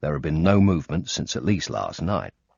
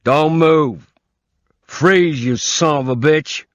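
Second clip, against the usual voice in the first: much higher, loud startling yell from behind; sudden shout; 11 semitones higher, 9 dB louder, slower than usual. Acted. Old man shouting at an intruder in his garage, loud yell. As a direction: bark it out to freeze an intruder. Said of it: "there was a shout".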